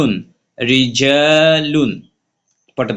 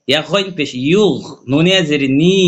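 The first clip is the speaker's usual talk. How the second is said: ordinary delivery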